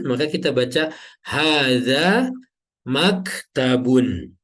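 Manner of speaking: maktabun with the -un ending, slow and clear; teacher models maktabun slowly, stressing the final -un; correct reading maktabun. Shown as a demonstration. Teacher to students, modelling the right case ending slowly. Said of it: "Tidak mungkin atau tidak boleh kita baca hadza maktabin".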